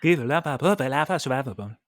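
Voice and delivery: quick babble of nonsense syllables; mock Simlish. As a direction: rattle off fast gibberish like a Sim. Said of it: "speaking Simlish over here".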